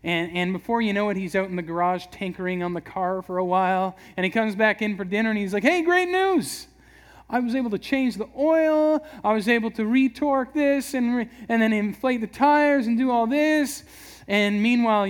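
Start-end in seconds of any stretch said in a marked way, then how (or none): 5.6-13.8 bursting to share good news; proud excitement. Retold or imitated. Husband back from the garage, proudly eager.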